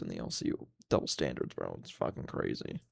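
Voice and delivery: breathy whispered aside, no voice. Real, not acted. Streamer repeating his outrage to chat, whispered. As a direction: drop to a whisper on it.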